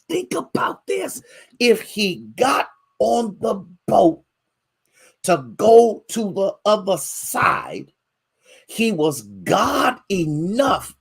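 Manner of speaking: high, slow, ringing preacher cadence; preaching at full force. Acted. Pastor preaching to his congregation, fervent high preaching. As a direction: stretch every phrase out to the pews.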